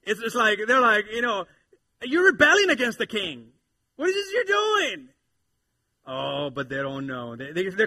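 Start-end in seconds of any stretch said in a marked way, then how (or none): 2.0-5.1 loud, very high mock accusation; hammed-up pompous outrage; scoffing villain. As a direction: accuse them in an exaggerated high voice. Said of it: "I know it's distorted".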